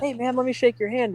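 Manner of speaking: high, eager, half-laughing hello; eager fan greeting. Retold or imitated. Fan asking to shake the driver's hand, eager and high. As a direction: gush like a starstruck fan.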